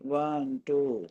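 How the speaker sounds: ordinary talk, nothing marked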